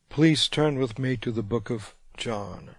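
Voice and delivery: ordinary talk, nothing marked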